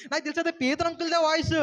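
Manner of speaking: put-on high, happy child bursting with voice; joyful. Girl tells the elders joyfully, high and happy. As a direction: say it gladly, rising on voice. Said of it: "సంతోషంతో ఆనందంతో చెప్తుంది".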